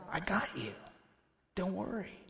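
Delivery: soft, quiet comfort; hushed gentle comfort to a scared man; tender reassurance. Acted. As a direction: hold him with your voice. Jesus comforting softly.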